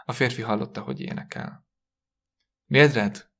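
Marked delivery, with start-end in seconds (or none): 2.6-3.3 calling out. A narrator's reading. Husband calls his wife from the parlour, calling out. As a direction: call her name out loudly. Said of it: "hívta".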